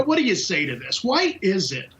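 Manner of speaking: ordinary talk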